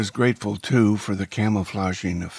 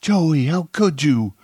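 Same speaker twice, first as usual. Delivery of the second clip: high, loud anguished reproach; distraught; 9 semitones higher, 8 dB louder than usual. A narrator's reading. Woman blames accomplice for the death, distraught. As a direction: reproach him in loud dismay. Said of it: "Monica was distraught".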